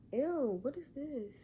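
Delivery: recoiling in disgust; grossed out. Real, not acted. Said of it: "this stuff in my mouth".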